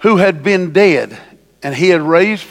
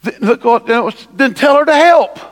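higher, fast, exasperated complaint; hammed-up whine of an overworked sister; ticked-off Martha; 8 semitones higher, 4 dB louder than usual. Martha complains to Jesus about her sister, in hammed-up exasperation. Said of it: "She said".

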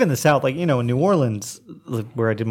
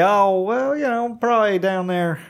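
higher, drawn-out Okie impression; Okie accent; 8 semitones higher than usual. Shown as a demonstration. Demonstrating Okie talk to the interviewer, Okie accent. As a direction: lay on the Okie accent, pitched up. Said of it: "I can talk like an Okie".

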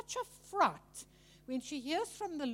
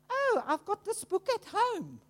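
higher, louder brush-off quote; acted excuse; 7 semitones higher, 5 dB louder than usual. Someone declining an offered book, acted excuse. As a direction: mimic someone breezily turning down the book.

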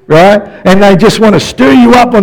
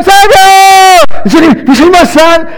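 much higher and louder, frantic; panicked yelling; 12 semitones higher, 4 dB louder than usual. Retold or imitated. Dad yelling across the store for his son, frantic shout. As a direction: shout for the lost child in panic. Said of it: "and then you start yelling".

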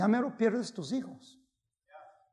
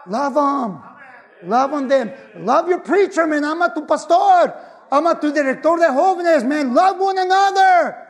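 loud, high shouted exhortation; fiery call to love one another; preaching at full force; 8 semitones higher, 11 dB louder than usual. Real, not acted. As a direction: shout the command to the congregation.

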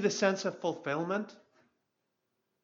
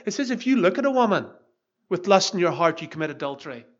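forceful preaching; 6 dB louder than usual. Acted. Preaching builds to full force.

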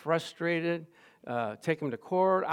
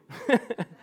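laugh; 7 semitones higher than usual. Real, not acted. Laugh after the punchline.

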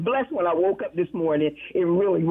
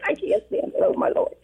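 breathless whisper; 9 semitones higher than usual. Real, not acted. Overwhelmed caller on the radio.

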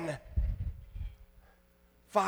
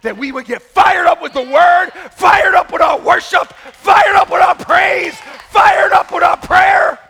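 loud, high, building sermon shout; fiery preaching; 6 semitones higher, 9 dB louder than usual. Acted. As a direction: fire the church up with every line. Preaching at full force.

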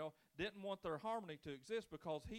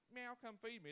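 polite cat; 6 semitones higher than usual. Retold or imitated. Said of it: "it's like a grouchy meow".

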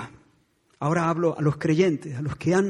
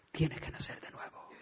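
"Tienes que nacer de nuevo" whispered, very soft and breathless; 12 dB quieter than usual. Shown as a demonstration. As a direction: let it fall to a secret whisper. Repeats the phrase, then whispers it.

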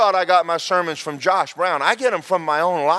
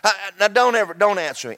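ordinary talk

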